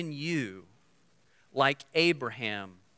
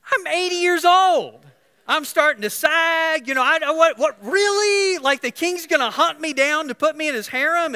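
put-on high, loud, disbelieving old wife; Sarah's incredulous thoughts; 13 semitones higher, 9 dB louder than usual. Preacher voicing Sarah's disbelief, high and loud. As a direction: squeal it like an eighty-year-old who can't believe it. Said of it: "she's gotta be thinking".

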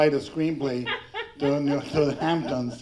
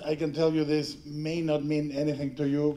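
ordinary talk, nothing marked

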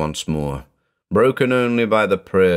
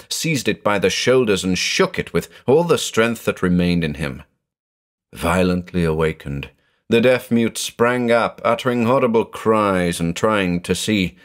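plain reading voice

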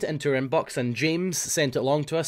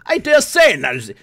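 put-on posh English accent, much higher and louder; 10 semitones higher, 11 dB louder than usual. Continuing posh English act.

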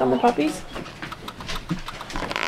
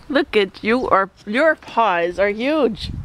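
ordinary talk, nothing marked

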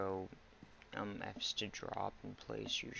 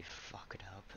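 whispered; 9 dB quieter than usual. Real, not acted. Mistake noticed in game.

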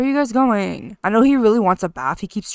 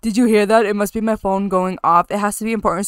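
ordinary talk, nothing marked

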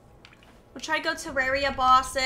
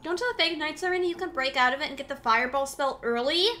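normal talking voice

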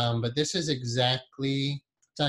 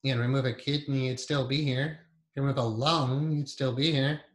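guided meditation. Acted. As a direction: ease them inward with long pauses.

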